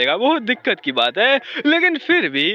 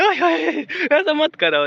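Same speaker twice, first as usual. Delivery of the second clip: startled protest; 6 semitones higher, slower than usual. A narrator's reading. Startled by touch on back.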